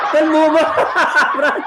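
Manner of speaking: high, loud laughing question; laughing tease. Real, not acted. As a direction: crack up while asking. Cracks up while teasing friend.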